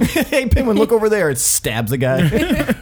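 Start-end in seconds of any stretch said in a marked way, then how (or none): 0.0-1.3 high, laughing fake-innocent distraction; sneaky high decoy voice; mock sneaky voice. Mock-voicing the character sneaking off.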